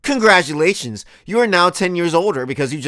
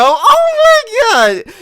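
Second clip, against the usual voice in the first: high, slow, loud disbelief; incredulous shriek; 7 dB louder, much slower than usual. Real, not acted. Shock at the ad.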